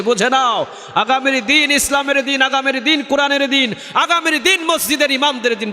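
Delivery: high-pitched rally cry; rousing proclamation. Real, not acted. Preacher rallies congregation about the coming days, high impassioned shouting. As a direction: hammer out each promise high, to rouse the crowd.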